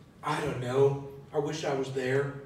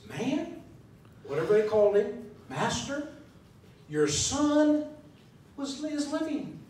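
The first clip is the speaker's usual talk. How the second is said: excited good news; 7 semitones higher than usual. Acted. Servants bring good news.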